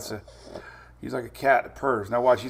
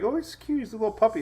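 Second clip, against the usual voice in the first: much higher, cooing voice; imitating gushing people; 9 semitones higher than usual. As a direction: gush over a cute puppy. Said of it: "Everybody's like".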